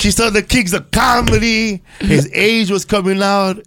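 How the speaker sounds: lightly voiced high excited girl; half-sung starstruck gushing; young stripper voice. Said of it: "She goes".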